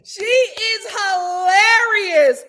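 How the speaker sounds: loud slow laughing words. Real, not acted. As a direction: say it while cracking up. Laughing hard.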